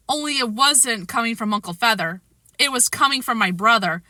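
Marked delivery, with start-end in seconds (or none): none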